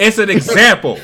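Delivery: high, loud laughing words. Real, not acted. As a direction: say it through laughter. Laughing defence.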